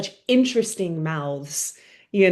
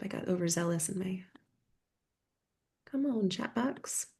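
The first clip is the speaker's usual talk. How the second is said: quiet whispered aside; whispering; 11 dB quieter than usual. Real, not acted. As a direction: mutter it under your breath. Muttering to herself about chat box.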